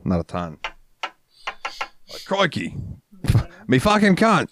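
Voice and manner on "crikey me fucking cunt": mock Australian, high and hammed; a jokey Aussie "crikey"; Australian accent. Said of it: "a little bit of Australian".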